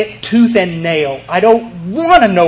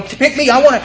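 Horshack eagerness; 7 semitones higher than usual. Retold or imitated. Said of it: "I'm like Horshack".

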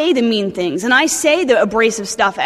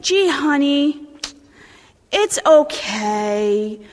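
mocking gentle tone; much slower than usual. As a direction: parody an overly gentle sponsor, drawn out. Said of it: "very, very gentle".

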